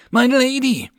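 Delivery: high loud urgent call. Acted. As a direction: shout to get her attention. Officer calls out to her.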